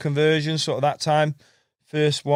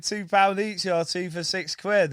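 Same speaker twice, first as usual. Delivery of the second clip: slow mock hawker's cry, pitched up; retold trader's shout; 7 semitones higher, slower than usual. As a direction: imitate a market seller calling prices. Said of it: "I remember once I shouted".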